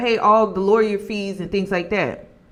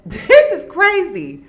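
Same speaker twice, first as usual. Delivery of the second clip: laughing; 7 semitones higher, 8 dB louder, slower than usual. Real, not acted. Laughing at absurd news.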